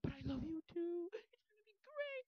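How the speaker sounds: very high, slow, gushing mock voice; emotional bot. Bot gushing to Junie, lovesick. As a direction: gush in a tiny high voice, not shouting. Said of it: "developing emotions".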